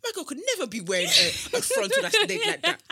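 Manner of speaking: mock outrage. Friend mimics disapproving posts about girls, laughing mock outrage. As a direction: act scandalised while cracking up.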